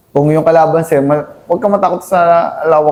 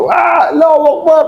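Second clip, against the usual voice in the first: battle cry shout; 13 semitones higher than usual. Retold or imitated. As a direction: yell it like charging into a firefight. Comrade's battle cry in firefight.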